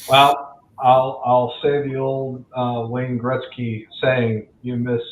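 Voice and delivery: ordinary talk, nothing marked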